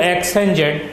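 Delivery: slow, word by word for notes; slow dictation. Shown as a demonstration. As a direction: dictate each word slowly so students can write. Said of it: "लिखिए, therefore". (धीमे-धीमे बोलकर लिखवाना)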